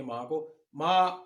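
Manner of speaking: माँ rising upward, second tone. Shown as a demonstration. Teacher demonstrating माँ in the rising second tone. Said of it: "rising tone".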